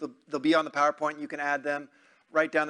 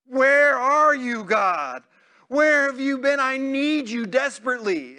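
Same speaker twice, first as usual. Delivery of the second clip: much higher and louder desperate cry to God; desperate psalmist; 8 semitones higher, 7 dB louder, slower than usual. Acted. Preacher voices David crying out to God, desperate. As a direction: plead like a man abandoned by God. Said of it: "What does the next psalm say?".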